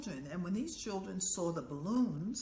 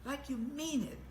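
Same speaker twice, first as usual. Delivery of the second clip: higher, insistent no, like you mean it; urging correction; 7 semitones higher than usual. Retold or imitated. Teacher urging children to chant with feeling. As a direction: push them to mean it. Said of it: "And I said".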